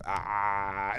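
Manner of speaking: low, weak pitiful groan; sick groan. Retold or imitated. Sick moan.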